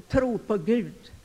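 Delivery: usual speaking voice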